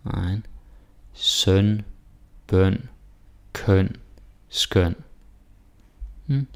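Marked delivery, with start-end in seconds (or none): 1.1-4.9 "søn, bøn, køn, skøn" with rounded Danish ø; Danish ø sounds. Shown as a demonstration. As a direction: say each word slowly with lips rounded on ø. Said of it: "here we got Ø S T".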